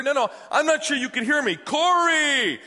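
high, loud call to the back seat; retold yelling. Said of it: "I'm ye-, I'm yelling".

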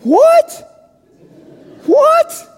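high squeaked shocked "What?"; comic shocked double take. Retold or imitated. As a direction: leap way up in pitch in disbelief. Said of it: "I say".

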